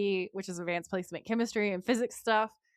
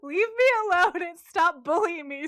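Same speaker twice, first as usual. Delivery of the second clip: whiny, higher protest; mock whiny protest; 7 semitones higher, 4 dB louder than usual. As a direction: whine it like you're being bullied. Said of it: "I was like".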